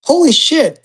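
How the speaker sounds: mildly starstruck exclamation, pitch jumping up; retold amazement. Retold shock at stars behind him.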